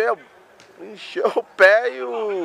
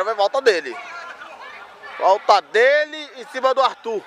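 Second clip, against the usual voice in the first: ordinary talk, nothing marked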